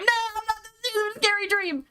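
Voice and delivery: high-pitched scared whine; real nervous squeal of refusal; scared protest. Real, not acted. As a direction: balk in a high, frightened voice. Frightened reaction to game dream.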